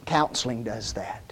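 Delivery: hushed confiding whisper; whispered aside. Real, not acted. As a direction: drop to a whisper, as if sharing a secret. Aside to congregation.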